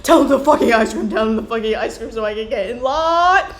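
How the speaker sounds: loud, laughing exasperated yell; waiting customer losing patience, loud; frustrated outburst. Retold or imitated. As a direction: blow up at the dithering kid. Impatient customer's inner outburst.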